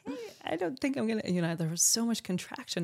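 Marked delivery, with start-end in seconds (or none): 0.0-1.2 doubting self. Retold or imitated. Retold call to Peggy.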